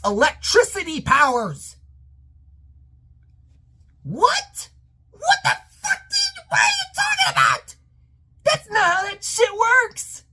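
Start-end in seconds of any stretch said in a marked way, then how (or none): 4.0-10.2 high-pitched, incredulous screech; outraged disbelief. Real, not acted. Fan ranting about the symbiote getting electricity powers, outraged disbelief. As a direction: shriek in exasperated disbelief at nonsense. Ranting outburst at the film's plot.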